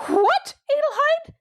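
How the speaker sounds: shocked housekeeper crying out her name; indignant cry. A narrator's reading. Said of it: "she cried".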